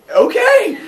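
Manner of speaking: very high, loud laughing okay; nervous laugh. Acted. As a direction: squeak it out, laughing in disbelief. Hephaestus nervous agreement.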